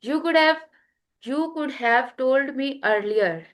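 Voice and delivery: "could have" blended into a quick "could've", slow line; could've contraction. Shown as a demonstration. Teacher demonstrating the actual pronunciation to students, slow. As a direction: say it the way native speakers do. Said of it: "this is the actual pronunciation of this contraction".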